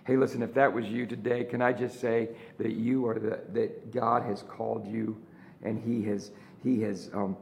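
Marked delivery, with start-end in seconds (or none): none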